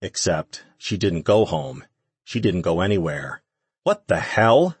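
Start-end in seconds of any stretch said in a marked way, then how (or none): 3.8-4.8 sharp, high angry snap; barked. A narrator's reading. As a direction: bark it out in anger. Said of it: "she barked".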